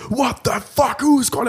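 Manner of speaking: high strained swearing rant; raging outburst. Retold or imitated. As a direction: explode at the ringing phone. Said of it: "er rastet völlig aus".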